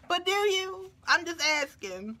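high-pitched put-on London accent; mock London accent. Asking British viewers if they mind, mock London accent. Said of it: "when people mock y'all accent".

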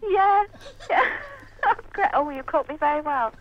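normal talking voice

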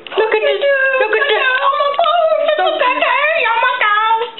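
mock-excited, sky-high squeal; high-pitched gushing. Friend showing the audience a kitty, squealed. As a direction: squeal it on one very high note.

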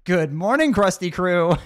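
high, loud, bright wake-up call; loud cheerful good-morning to the crew; loud cheerful greeting. Acted. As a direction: greet the whole crew with a shout.

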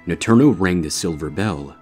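ordinary delivery

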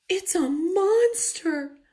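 high, slow moan. A narrator's reading. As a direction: moan it in dread. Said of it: "moaned Mama Bear".

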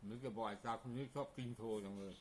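talking while chewing; words round a bite of food; mouth full. Real, not acted.

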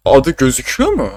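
real crack upward mid-question. Real, not acted. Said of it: "Sesime ne oluyor lan?".

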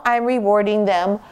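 ordinary talk, nothing marked

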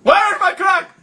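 high-pitched, urgent demand in a put-on accent. Demanding on a prank call.

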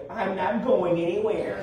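prim, proper phrasing with a laugh; bougie proper version. Shown as a demonstration. For the congregation too bougie for slang, prim and laughing. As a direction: say it the polished, bougie way. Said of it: "too bougie to say that".

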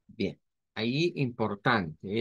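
ordinary talk

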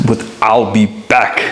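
slow, flat robotic Terminator line; Terminator impression. Auditioner sneaking in a slow Terminator catchphrase. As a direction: deliver it like the cyborg, slow and deadpan. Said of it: "I did it again".

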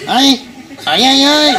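drawn-out "ay" wailing; hammed-up childish sobbing; imitated child crying. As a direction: keep bawling like a little boy. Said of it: "el niño seguía llorando".

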